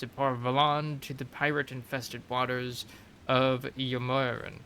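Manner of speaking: measured storyteller read of a codex entry; lore narration. Acted. Reading a game codex entry to viewers, storyteller narration. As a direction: narrate it like a history tome.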